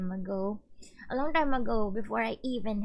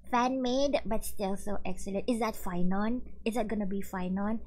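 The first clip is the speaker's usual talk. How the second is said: ordinary talk, nothing marked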